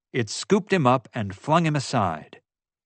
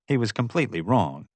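plain reading voice

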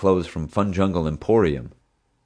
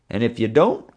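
stern cop voice, pitched up; warning tough cop; 5 semitones higher, 4 dB louder than usual. A narrator's reading. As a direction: lean in and warn him hard. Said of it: "he warned Hank".

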